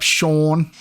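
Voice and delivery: SEAN stretched into "Shawwn"; drawn-out SEAN. Shown as a demonstration. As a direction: hold a long "aw" in SEAN. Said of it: "gotta say it like that".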